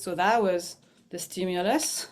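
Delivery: normal talking voice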